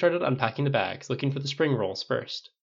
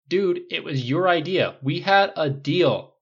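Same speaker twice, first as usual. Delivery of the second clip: higher, louder, drawn-out complaint; indignant protest; 6 semitones higher, 7 dB louder, slower than usual. A narrator's reading. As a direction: object loudly, stretching the words out slowly. Acted protest over a deal.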